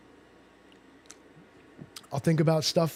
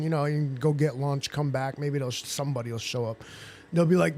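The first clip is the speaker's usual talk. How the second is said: normal talking voice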